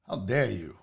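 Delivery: low, soft English affront; quiet English indignation. Acted. Host showing off accents to chat, low English indignation. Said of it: "Katie said the accents".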